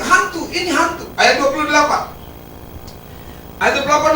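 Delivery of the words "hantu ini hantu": lifted pitch, a hint of panic at the ghost; mock frightened cry. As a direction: cry out 'Hantu, ini hantu' in a higher, scared voice. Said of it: "mereka teriak". (teriakan takut yang dibuat-buat)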